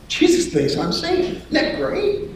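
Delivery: high, squeaky fake delight; sarcastic falsetto glee; mock-cheerful announcement. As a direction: chirp terrible news as if great. Mock happy news to wife.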